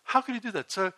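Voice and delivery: usual speaking voice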